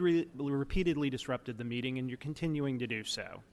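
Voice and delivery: ordinary talk, nothing marked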